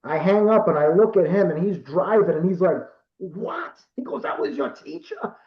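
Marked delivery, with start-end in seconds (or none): 3.2-3.8 softer, higher mock-stunned question; retold disbelief. Driving friend reacts to the call, retold disbelief. 4.2-5.3 high, softer incredulous question; retold disbelief. Driving friend asks about the teacher's call, retold disbelief.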